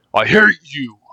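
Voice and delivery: fierce accusation; angry outburst. Acted. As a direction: spit it at him. Quarrel with kidnapper.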